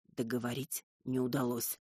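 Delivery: plain reading voice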